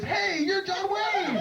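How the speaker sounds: high, slow, laughing yell; excited fan shout. Retold or imitated. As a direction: fans spin round and shout, high. Fans in men's room shout.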